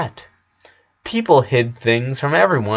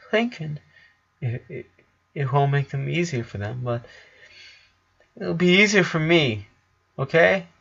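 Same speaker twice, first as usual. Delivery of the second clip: breaking voice; 3 semitones higher, 5 dB quieter than usual. A narrator's reading. Lincoln holding back tears.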